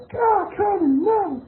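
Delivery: high, loud cry of protest; furious refusal. Acted. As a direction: cry out no at him in outrage. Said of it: "Christina furiously refuted".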